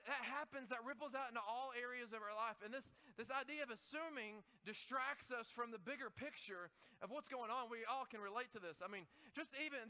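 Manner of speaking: ordinary delivery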